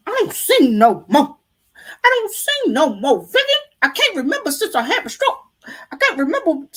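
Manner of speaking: put-on high, loud quoting of an elderly singer; old church lady. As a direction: play the frail old lady turning down the request. Voicing elderly church lady after stroke.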